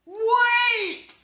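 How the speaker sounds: high loud call. Acted. Mister Brown calling after the ice cream seller. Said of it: "called Mister Brown".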